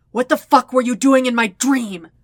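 feral, furious demand; snarling. Acted. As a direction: spit the words out like fire. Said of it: "Zuko snarls".

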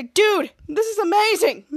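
high, loud excitement; thrilled. Acted. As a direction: gush to a friend, thrilled. Character thrilled by the gift.

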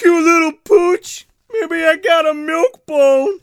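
very high, loud, drawn-out character voice; mock frantic impression. Rick Moranis coaxing the terror dog, mock frantic impression. Quoting the same frantic film scene.

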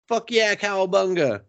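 high, goofy cheer; mock glee. Boy thrilled the girl is the mayor's daughter, goofy high cheer. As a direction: whoop like a surfer dude. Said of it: "He's like".

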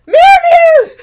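high, loud screech of a name. Acted. Marion startled as a creature races past, loud screech. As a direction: shriek at the cat in alarm. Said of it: "Marion screeched".

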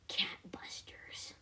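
slow unvoiced whisper. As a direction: whisper it slowly like a secret. Whispered answer to the chant.

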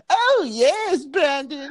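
cheery, very high theatrical lady voice; a bubbly, hammed-up high trill; Constance Collier. Podcaster mimicking a bubbly actress in Rope, very high impression. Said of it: "and she's like".

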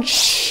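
imitated disciples' shush; soft, drawn-out hushing hiss. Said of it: "Los discípulos dijeron". (chistido imitado para callar)

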